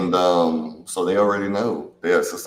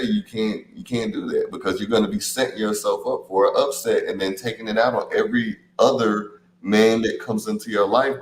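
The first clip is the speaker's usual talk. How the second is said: usual speaking voice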